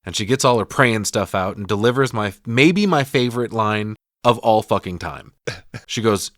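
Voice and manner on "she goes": ordinary delivery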